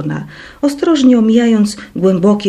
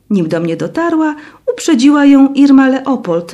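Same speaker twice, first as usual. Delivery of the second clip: ordinary delivery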